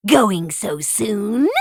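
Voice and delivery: slow mocking witch voice. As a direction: drag it out, taunting. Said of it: "the witch cried".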